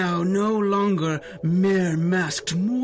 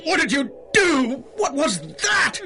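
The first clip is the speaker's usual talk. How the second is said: alarmed shout; 8 semitones higher than usual. Acted. Villain struck by the heroes' device, alarmed shout. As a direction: shout in shock at the pain.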